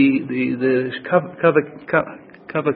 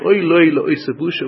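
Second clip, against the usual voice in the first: ordinary talk, nothing marked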